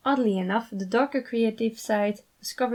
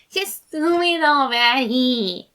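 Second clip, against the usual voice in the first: higher, loud and slow whine; impatient whine; 5 semitones higher, 7 dB louder, slower than usual. A narrator's reading. Remus whining at the hesitant sides, slow and loud. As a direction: drag it out impatiently. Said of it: "whined impatiently".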